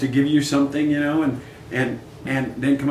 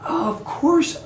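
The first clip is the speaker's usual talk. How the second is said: high rising oh of course; sudden high oh of course; mock sudden recall; 13 semitones higher, much slower than usual. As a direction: fake it like it just came back.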